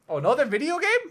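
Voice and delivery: very high, loud complaint; exasperated outburst. Real, not acted. Host learning it is another game, loud exasperated cry. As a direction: yelp it in comic dismay.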